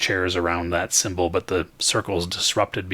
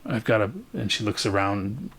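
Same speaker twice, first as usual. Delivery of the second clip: ordinary talk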